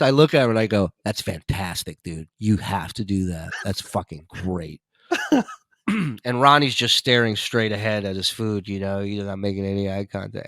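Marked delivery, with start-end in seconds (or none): 1.0-4.8 deadpan. Retold or imitated. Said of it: "with a straight face".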